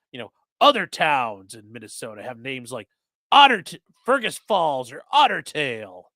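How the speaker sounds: hammed-up sponsor read for chat; mock ad read. Streamer reading a mock sponsor spot to chat, hammed-up ad read. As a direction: sell the bar like a joking local pitchman.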